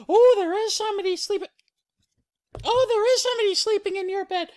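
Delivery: fast, loud falsetto; Mama Bear alarmed. Acted. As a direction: gasp it out high and hurried. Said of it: "Mama Bear".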